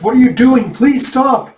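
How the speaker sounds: high, loud acted protest; alarmed pleading. Villager protesting as officials smash everything, alarmed. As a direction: beg them to stop, urgently.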